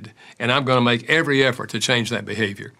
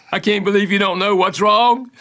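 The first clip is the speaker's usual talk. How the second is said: higher-pitched mock hurt wife; upset wife; 7 semitones higher, 3 dB louder than usual. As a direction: lightly imitate a wife's wounded disbelief, pitched up. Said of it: "and she's crying".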